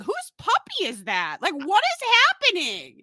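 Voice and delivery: rising to high, loud disbelief; confused surprise. Retold or imitated. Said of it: "and I was like".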